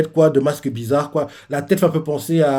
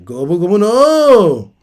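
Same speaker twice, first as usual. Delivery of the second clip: a drawn-out, much louder and higher attack call; attack battle cry; 6 semitones higher, 8 dB louder, slower than usual. Retold or imitated. Luffy calling his attack, slow loud battle cry. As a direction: belt out the move name slowly.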